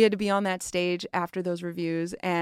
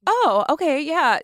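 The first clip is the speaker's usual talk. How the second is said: higher, louder retold surprise; retold realisation; 8 semitones higher, 8 dB louder than usual. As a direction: act out a sudden, embarrassed catching-on. Retold reaction to learning seats cost.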